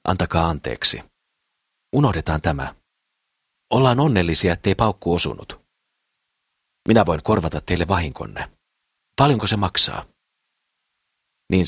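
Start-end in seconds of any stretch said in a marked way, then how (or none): none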